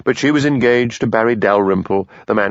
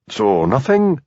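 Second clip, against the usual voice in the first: sharp; 9 semitones higher, slower than usual. Acted. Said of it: "said Hamish sharply".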